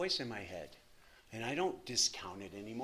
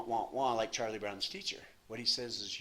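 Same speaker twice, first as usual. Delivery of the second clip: normal talking voice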